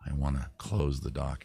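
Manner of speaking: ordinary delivery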